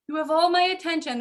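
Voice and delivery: mock eager parent. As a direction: offer yourself gladly to the kids. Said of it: "I was like".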